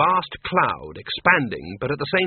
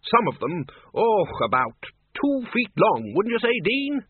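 play-acted exaggeration; 9 semitones higher than usual. A narrator's reading. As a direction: invite the Dean to back you up. Ridcully loudly play-acts for bogeyman.

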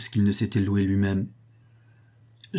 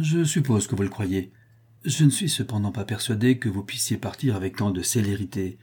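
ordinary delivery

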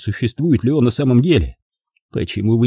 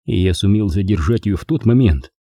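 ordinary delivery, nothing marked

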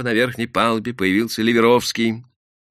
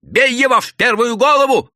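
very loud, high yell; орёт; 8 dB louder than usual. A narrator's reading. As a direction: bellow the order to the mob. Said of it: "заорал заросший мужик". (яростный ор)

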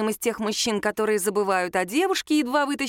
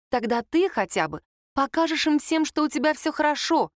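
firm, raised-pitch assurance; confident; 7 semitones higher than usual. A narrator's reading. Sasha encouraging her frightened friend, confident. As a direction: declare it with conviction. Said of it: "уверенно заявила Саша".

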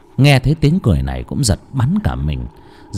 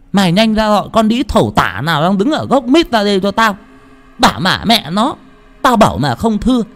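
high, harsh commanding rant; angry orders; 9 semitones higher than usual. A narrator's reading. Tẹo ordering Thơm to fetch the intruder, angry. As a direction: bark the order and the threat.

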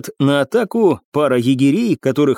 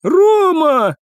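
high, drawn-out cry of relief; relieved exclamation; 4 dB louder than usual. A narrator's reading. Cadet sees his missing comrade, relieved cry. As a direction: call out to a friend given up for lost. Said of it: "облегчённо воскликнул".

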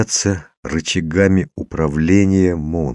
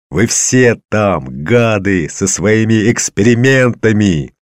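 loud, high, drawn-out accusation; furious yell; 5 semitones higher, 5 dB louder, slower than usual. A narrator's reading. Father rages at the experimenters, yelling. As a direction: hurl it at them, stretching the words. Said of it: "заорал я не своим голосом".

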